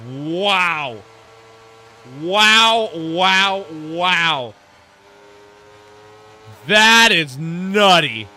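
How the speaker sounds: loud, drawn-out amazed wows; stunned wow. Real, not acted. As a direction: gasp it out in disbelief. Commentator stunned by a crash.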